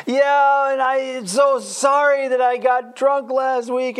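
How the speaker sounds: hamming up a showy sorry; mock teen confession. Teen one-upping confession in circle.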